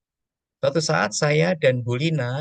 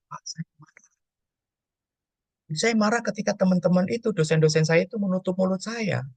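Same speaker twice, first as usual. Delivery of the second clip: imitating an angry girl, higher pitch; mock outburst of a hurt student, higher; 7 semitones higher than usual. Pastor retelling a student telling him she is angry, imitated high voice.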